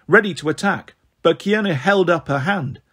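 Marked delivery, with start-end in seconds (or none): none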